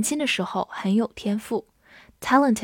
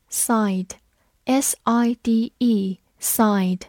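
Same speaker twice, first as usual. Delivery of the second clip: slow, letter by letter; teacher spelling a word slowly; much slower than usual. Shown as a demonstration. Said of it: "spells out the word".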